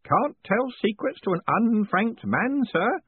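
put-on high, slow, solemn old postman; pious old postman. A narrator's reading. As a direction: say it piously, like a secret rite. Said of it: "said Groat piously".

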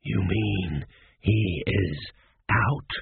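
deep, very slow undead voice; ancient mummy. A narrator's reading. Ancient mummy questions the news, low and very slow. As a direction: ask it low, with long drags on each word.

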